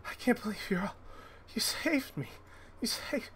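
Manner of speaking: high, hushed thanks through tears; rescued father thanking the party, high and tearful; overcome father. Acted. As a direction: barely get the words out, high and soft.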